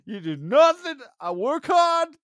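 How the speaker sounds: very high, loud, strained screech; mock yelling. Acting out a hard-working partner's complaint, screeching in mock outrage. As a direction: yell it like an over-the-top aggrieved partner. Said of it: "like, yelling every episode".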